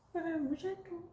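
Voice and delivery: high, soft cooing praise; squeaky praise for a pet. Real, not acted. Praising the cat, higher.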